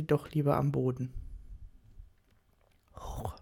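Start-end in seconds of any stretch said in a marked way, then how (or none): 2.9-3.4 low-volume whisper, all breath; whispered command. A narrator's reading. Husband commands his kneeling wife to rise, whispered. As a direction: whisper it as a soft order. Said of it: "flüsterte er".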